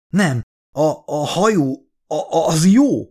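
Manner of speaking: high, faltering reluctant answer; hesitant stammer. A narrator's reading. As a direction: stammer it out after wavering. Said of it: "nyögte ki egy kis tétovázás után".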